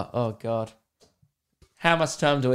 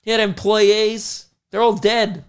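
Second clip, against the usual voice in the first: mock outrage; 8 semitones higher, 4 dB louder than usual. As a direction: protest for the forgotten victims. Acted outrage for movie victims.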